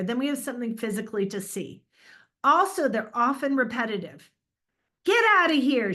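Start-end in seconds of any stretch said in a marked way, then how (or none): 5.0-5.9 high, loud mock yell; demonstration yell. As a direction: yell it as a sample line of dialogue. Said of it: "she yelled".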